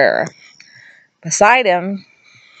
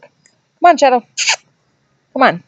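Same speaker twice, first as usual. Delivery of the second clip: calling the dog; 7 semitones higher than usual. Real, not acted. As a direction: call her over, sharp and bright. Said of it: "my dog's being a jerk".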